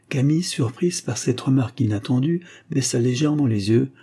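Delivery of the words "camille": usual speaking voice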